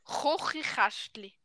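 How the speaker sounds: slow 'Kochikastli' with throaty 'ch' for each K; 'Kochikastli' said as 'Chochichäschtli'; Swiss German tongue-twister. Shown as a demonstration. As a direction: scrape out 'Kochikastli' slowly. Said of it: "wo fast niemand aussprechen kann".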